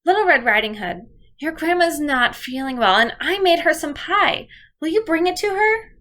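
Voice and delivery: a hint of a gentle mom asking a favour; mother voicing. Shown as a demonstration. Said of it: "here's an example of what I might do".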